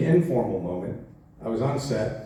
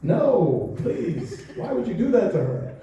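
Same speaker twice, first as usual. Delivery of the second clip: laughing dismay; 7 semitones higher, slower than usual. Retold or imitated. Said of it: "I was just like".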